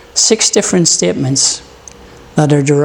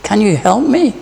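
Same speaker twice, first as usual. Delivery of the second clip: higher, pleading call for help; 5 semitones higher than usual. Acted. Man hanging over the cliff begging for help, pleading. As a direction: beg for rescue. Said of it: "The man asked".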